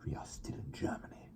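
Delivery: hushed whispered warning; a mother hushing her child in a whisper. A narrator's reading. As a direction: whisper it sharply so no one hears.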